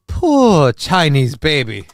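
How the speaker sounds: put-on slow echo of the narrator's Poor Chinese baby; imitating the narrator. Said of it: "get a sound drop off that".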